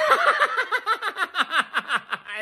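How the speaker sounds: high cackling laugh; gleeful laugh. Real, not acted. Fan cackling after taunting the Cowboys, gleeful laugh. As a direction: let the laugh burst out. Gloating over rival's loss.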